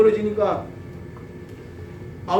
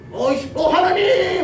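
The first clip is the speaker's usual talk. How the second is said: higher, exasperated call to God; frustrated cry; 10 semitones higher, slower than usual. Retold or imitated. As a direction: groan then cry out. Moses frustrated.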